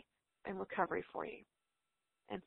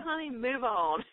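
laughing; 6 dB louder, slower than usual. Real, not acted. Laughing reply to mom.

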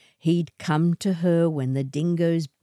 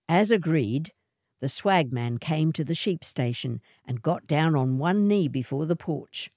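usual speaking voice

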